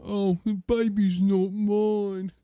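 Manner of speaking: drawn-out mock elephant denial; puppet elephant. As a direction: moan it out slowly as the puppet elephant. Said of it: "sound of the elephant from Meet the Feebles".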